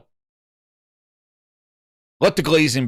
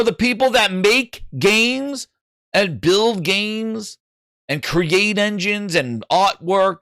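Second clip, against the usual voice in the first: ordinary delivery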